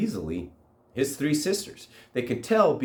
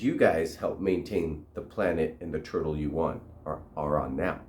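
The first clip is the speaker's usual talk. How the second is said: low elder voice; 7 semitones lower, 4 dB quieter than usual. Acted. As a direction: speak low like an ancient turtle. Turtle elder explains in deep voice.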